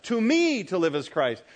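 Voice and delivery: "To me" stressed high at the front. Shown as a demonstration. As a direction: punch "To me", then fall away. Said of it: "So to me is emphasized".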